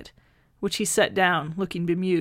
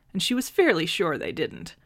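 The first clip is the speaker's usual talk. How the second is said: plain reading voice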